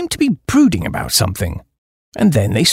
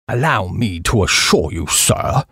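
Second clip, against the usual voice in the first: lower-pitched, understated odd restraint; rummy voice; 7 semitones lower, slower than usual. A narrator's reading. Old Duke affirming who he is, rummy voice. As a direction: lower the voice, slightly strange and stiff. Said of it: "he said in a rummy kind of voice".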